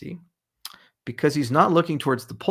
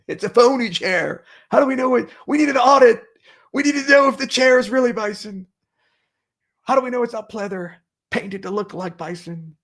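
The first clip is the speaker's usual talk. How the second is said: mock blustering pundit; 10 semitones higher, 7 dB louder than usual. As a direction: ham it up like a ranting TV blowhard. Mocking critics of his chair.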